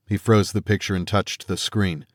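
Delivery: plain reading voice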